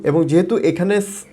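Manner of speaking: ordinary talk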